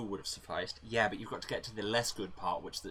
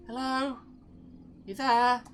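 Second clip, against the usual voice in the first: much higher, louder voice calling out; high comm caller; 8 dB louder, slower than usual. Acted. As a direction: call high and loud into the comm.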